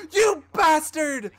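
angry yell. Real, not acted. Mock-angry at killer.

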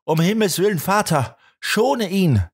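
screamed plea, desperate; frantic scream. A narrator's reading. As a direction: cry out begging him to stop. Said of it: "Dabei schrie sie".

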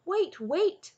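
loud, high-pitched alarm; urgent cry. A narrator's reading. Cole stopping Taryn at the anvil, urgent cry. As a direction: call out loudly to stop him. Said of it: "Cole cried".

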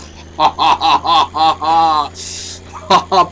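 loud rising maniacal laughter; manic laugh. Real, not acted. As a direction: cackle like you've lost it. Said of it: "Psikopata bağladım".

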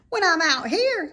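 high, loud piglet lament; whiny young pig.

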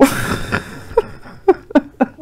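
high unvoiced laughing fit; burst of laughter. Real, not acted. Grandma cracking up at the story, high laughter. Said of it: "so funny".

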